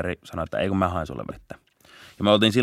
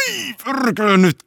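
much higher and louder, burst out; retold shocked yell; startled swearing; 9 semitones higher, 6 dB louder than usual. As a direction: blurt the curse as he runs. Suspect suddenly bolts.

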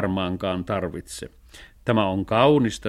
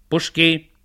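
higher, sharper order; command; 5 semitones higher than usual. A narrator's reading. Cat commanding the calf to butt, sharp order. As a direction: bark the order to attack. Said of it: "Kun Matroskin komentaa".

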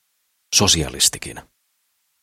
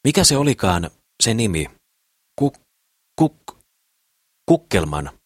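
higher, searching for the name; lightly voiced businessman, puzzled; 5 semitones higher than usual. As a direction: stumble over the odd name. (kevyesti esitetty liikemies, ihmettelevä)

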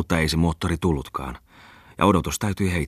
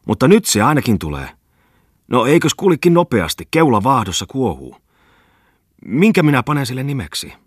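eager excitement; 8 semitones higher, 6 dB louder than usual. Acted. Bungman eagerly awaits his boat.

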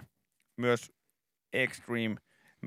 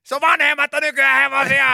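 high, loud gushing TV-host voice; much higher and louder, gushing reveal; overexcited makeover host; 13 semitones higher, 11 dB louder than usual. Retold or imitated. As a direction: sell the horse room like a hyped TV presenter. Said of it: "makeover host parody".

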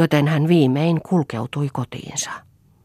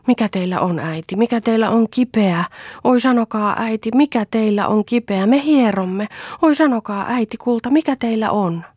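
put-on high, pleading child voices; anxious children; 8 semitones higher, 3 dB louder than usual. A narrator's reading. Children pleading with their weeping mother, anxious. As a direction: beg your mother to answer. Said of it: "Lapset ympärillä hätäisinä kyselivät".

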